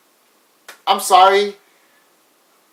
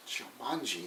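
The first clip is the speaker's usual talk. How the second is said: barely voiced, very soft and low; hushed; 7 semitones lower, 21 dB quieter, slower than usual. Robin Williams's character reading the dice, hushed awe. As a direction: breathe the name Jumanji almost silent. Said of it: "and he's like".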